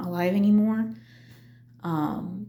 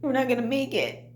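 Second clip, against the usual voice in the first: tearful despair; 7 semitones higher than usual. Retold or imitated. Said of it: "bawling my eyes out".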